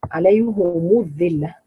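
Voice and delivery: عليه said "alayhu", drawn out slowly; slow recitation, عليه ending in a u sound; damma on عليه. Shown as a demonstration. Teacher confirming the reading for students, عليه with a damma. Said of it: "مين بيضم كده؟".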